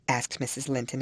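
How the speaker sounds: ordinary delivery, nothing marked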